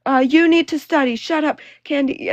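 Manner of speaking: loud, high hammed-up shouting; mock screaming. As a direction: mimic someone hurling accusations at full volume. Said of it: "would scream that I was a troll".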